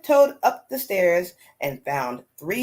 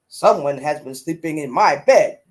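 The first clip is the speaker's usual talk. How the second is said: lower, louder Papa Bear growl; great big Papa Bear voice; 4 semitones lower, 4 dB louder than usual. A narrator's reading. Storyteller reading Papa Bear to listeners, low and loud. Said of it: "said in his great big voice".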